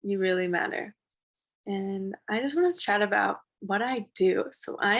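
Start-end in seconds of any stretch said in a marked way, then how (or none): none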